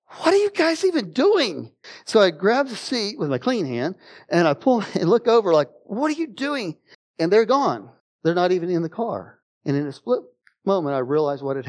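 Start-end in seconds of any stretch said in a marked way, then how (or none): 0.1-1.7 much higher, incredulous call; puzzled outburst at the hiding family; retold bewildered question; baffled. 5.8-6.8 much higher, softer bewildered question; peering over the seat, baffled; retold disbelieving query; puzzled.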